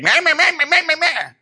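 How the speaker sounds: high, loud nasal-free meh-meh babble; mocking chatter. Preacher mocking wife's chatter with high meh babble.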